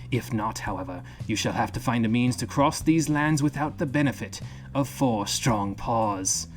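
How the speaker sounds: gravelly, gruff reading with a slight Australian lilt; John Muir's gruff voice. Acted. Streamer reads a book chapter to viewers in John Muir's gruff voice. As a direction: growl it out like a rugged old wanderer. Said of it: "kinda gravelly and gruff".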